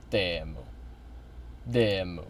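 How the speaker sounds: "Teemu" repeated low as TEH-moo; practised pronunciation. Shown as a demonstration. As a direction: practise "Teemu" softly with an eh vowel. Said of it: "I'll start saying it correctly".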